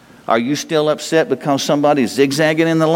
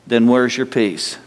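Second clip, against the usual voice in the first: ordinary delivery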